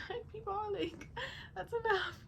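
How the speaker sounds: mock hush. Mock telling relatives to stop.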